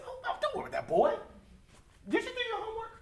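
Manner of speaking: high, fast, laughing mockery of dad; dismissive father, laughing. Father laughing off his crying daughter's worries. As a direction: brush off the crying child with a laugh. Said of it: "he said".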